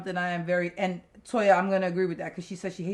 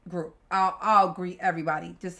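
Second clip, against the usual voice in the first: ordinary delivery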